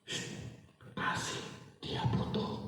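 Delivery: hushed, voiceless whisper; whispered prompting. Acted. God prompting the wife to give, soft whisper. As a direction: whisper it slowly into her heart. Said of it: "Tuhan itu seperti berbisik".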